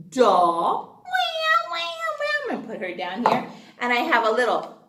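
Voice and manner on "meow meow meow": high mewing meow meow; playful cat meows. Pretend. Teacher meows while showing the doll to children. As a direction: mew like a little kitten.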